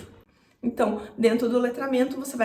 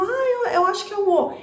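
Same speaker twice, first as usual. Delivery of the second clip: put-on child voice; 8 semitones higher, 4 dB louder than usual. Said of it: "Daí ele falou".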